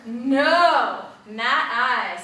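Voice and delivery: loud, drawn-out no; duck's refusal. A narrator's reading. Duck turning down the little red hen, emphatic refusal. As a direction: refuse firmly as the storybook duck. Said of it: "says the duck".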